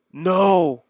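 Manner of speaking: loud, high anguished cry; cried out. A narrator's reading. Boy refusing to let the dying alien go, loud high cry. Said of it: "I cried".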